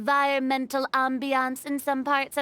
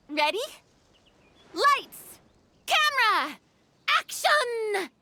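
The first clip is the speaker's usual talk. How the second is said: director's call; 5 semitones higher, slower than usual. Acted. Director calling a film take.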